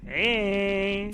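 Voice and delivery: high, loud drawn-out call; silly cheer. Pretend. Co-host answers the roll call at sign-off, silly cheer. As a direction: stretch the greeting into a goofy high cheer.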